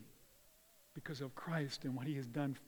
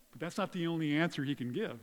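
usual speaking voice

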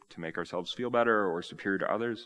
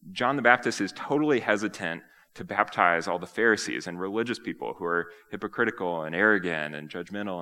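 ordinary delivery

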